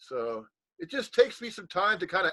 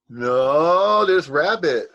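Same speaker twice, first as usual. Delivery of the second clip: loud, drawn-out excited cry; excited greeting; 8 dB louder, much slower than usual. Real, not acted. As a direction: greet a friend with a big swelling shout. Excitedly greeting Rabbit joining.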